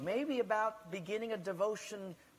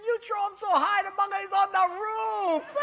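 high, loud laughing taunt; laughing Portuguese guy; 13 semitones higher, 6 dB louder than usual. Acted. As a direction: crow with laughter at the Hawaiian. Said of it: "the Portuguese guy's laughing".

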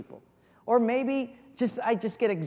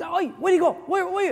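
high, loud, bewildered calls; confused guard shouting; 11 semitones higher, 6 dB louder than usual. Acted. Guards searching for the escaped prisoner, loud confused shout. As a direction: shout around looking for him.

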